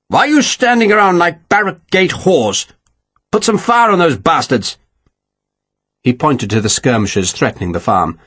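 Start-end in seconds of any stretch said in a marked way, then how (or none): 0.1-4.8 higher-pitched berating of the gunners; furious. A narrator's reading. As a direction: turn on idle men and order them to fire. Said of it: "He turned furiously on the gunners".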